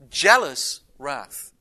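jealous wrath drawn out slow and loud; stressed phrase. Shown as a demonstration. Preacher drives home jealous wrath to the congregation, slow and loud. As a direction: lean hard on jealous wrath. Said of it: "repeats key phrase".

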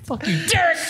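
mock angry yell. Repeated joke curse.

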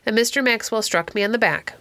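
ordinary delivery

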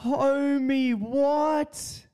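very high, drawn-out mock squeal; high-pitched mimicry. Imitating a viral video for his listeners, high-pitched mimicry.

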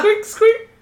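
very high squeaky toy noise; squeaky rubber duck. Pretend. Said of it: "I'm making the sounds".

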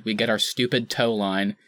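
normal talking voice

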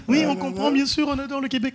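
high-pitched, gushing pretend understanding; feigned politeness. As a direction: play an overly polite French fan faking it. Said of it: "ils font semblant, ils sont juste polis".

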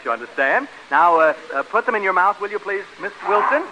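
ordinary delivery

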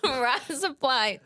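amused learner's Australian accent; Aussie accent. Shown as a demonstration. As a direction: try Aussie "Rise up, lights" through laughter. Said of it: "Razor blades in Australian".

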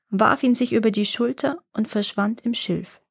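usual speaking voice

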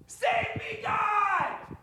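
very high, soft-edged falsetto cry; hammed-up drowning plea; panicked Peter scream. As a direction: squeal for help as you sink. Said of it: "I'm gonna help Sean here".

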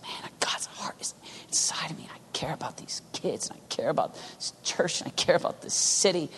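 very soft, half-whispered earnestness; hushed heartfelt confession. Acted. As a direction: confide it with aching care, under the breath. Acts out being moved by compassion.

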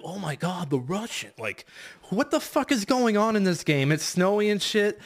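mock baffled outburst climbing high; Snake's surprise. Snake reacting to the snowy Russian base, mock baffled outburst. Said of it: "So he's like".

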